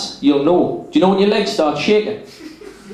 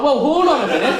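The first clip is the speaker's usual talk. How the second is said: higher, laughing alarm; startled protest; 6 semitones higher than usual. Retold or imitated. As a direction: yelp it out, caught off guard. Fingers shoved up, startled protest.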